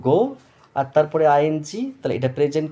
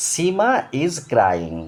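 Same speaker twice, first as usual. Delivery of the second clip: "Seema is crying" very slow with "Seema" raised in pitch; slow and high teacher reading; slow model reading; 4 semitones higher, much slower than usual. Shown as a demonstration. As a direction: dictate "Seema is crying" slowly, lifting the name. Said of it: "এবার দেখো".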